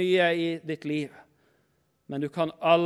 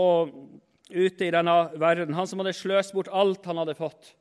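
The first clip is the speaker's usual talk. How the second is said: ordinary talk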